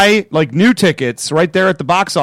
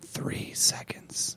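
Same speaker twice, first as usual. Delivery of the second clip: hushed whisper; 18 dB quieter, much slower than usual. Real, not acted. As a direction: whisper it softly. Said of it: "Why are we whispering?".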